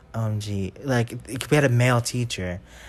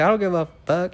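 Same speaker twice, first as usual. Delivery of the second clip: higher mock-defiant retort; defiant talk-back; 6 semitones higher than usual. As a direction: fire it back like a cheeky kid. Kid talking back to teacher.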